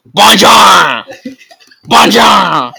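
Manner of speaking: high, loud cheerful Bonjour; teacher's loud greeting. Acted. Teacher greeting the class with a loud, high Bonjour. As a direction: belt it out like a jolly teacher. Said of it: "turned to the class and said".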